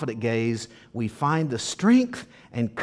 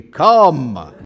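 big, loud, drawn-out call; commanding shout; 13 dB louder than usual. Shown as a demonstration. Jesus calling Peter onto the water, loud shout. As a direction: boom it out as a command. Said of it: "I don't know how he said the word come".

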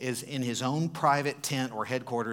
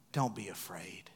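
soft whispered calm; calm reassurance; 4 semitones lower, 5 dB quieter than usual. Acted. Elisha calmly reassuring his frightened servant, whispered. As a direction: hush him gently, unbothered.

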